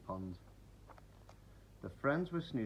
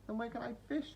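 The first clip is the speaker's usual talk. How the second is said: high, plaintive little question; a hint of sorrow; sadly; 13 semitones higher than usual. A narrator's reading. Kingfisher asking Duck, mildly sad. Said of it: "said Kingfisher sadly".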